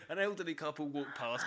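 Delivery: usual speaking voice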